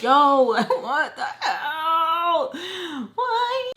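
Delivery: drawn-out laughing wail, rising very high; mock despair. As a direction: whine it out in hammed-up agony. Hammed-up dismay, half laughing.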